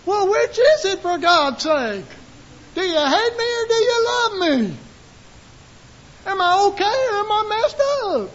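high, exasperated whine; mock confused listener. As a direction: play the bewildered believer. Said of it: "And you're going".

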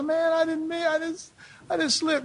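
high, softened excuse-making; acted sheepish confession. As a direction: plead that it was an accident. Voices someone confessing a slip.

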